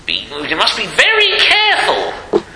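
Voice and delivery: high, laughing imitation of an old friend's warning; lightly mock the old man's earnest warning; John Marshall. Preacher imitating an old friend's warning, laughing. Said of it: "Remember old John Marshall, he used to say that".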